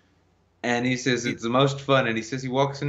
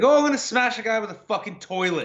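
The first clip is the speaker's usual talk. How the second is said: high, fast bad Irish accent; 10 semitones higher, faster than usual. Co-host quoting the movie, bad Irish accent. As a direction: imitate a film tough with a bad Irish accent. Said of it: "with the exception of the bad accents".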